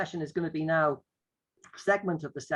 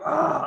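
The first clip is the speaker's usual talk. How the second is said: loud imitated lion roar; 10 semitones higher, 6 dB louder than usual. Next child becomes a lion, loud roar. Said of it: "the lion roars".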